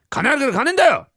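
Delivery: acted burst of parental temper; sudden loud snap. Father silencing his begging son, sudden loud snap. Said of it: "急にそんな大きな声出すこと".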